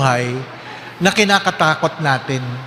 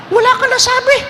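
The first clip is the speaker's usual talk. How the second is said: squeaky, higher, louder parent retort; high mock parent voice; 4 dB louder than usual. Parent scolding a silent child, high mock voice. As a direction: mimic a scolding mother, high and sharp.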